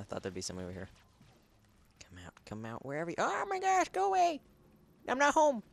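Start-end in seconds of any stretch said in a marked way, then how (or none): 2.1-2.9 soft teasing call; sing-song whisper. Pretend. Sneaking, teasing the enemy. 3.2-5.6 high, loud panicky squeal; panicked shriek. Real, not acted. Surprised by an enemy, panicking.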